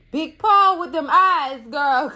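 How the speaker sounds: slow, loud gush over a handsome man; slow, loud swooning over Paul. Real, not acted.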